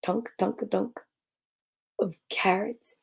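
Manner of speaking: soft, low, sinister hush fading to a whisper. A narrator's reading. Building suspense for the kids, soft and sinister. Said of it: "The soft, sinister".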